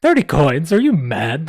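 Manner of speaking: high, loud scoffing disbelief. Acted. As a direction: scoff at the offer, outraged. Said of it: "the man scoffed".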